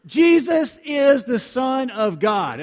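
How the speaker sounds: loud, slow, emphatic proclamation; confident declaration. Real, not acted. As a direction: proclaim it boldly, drawing out each word. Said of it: "We're confident in this".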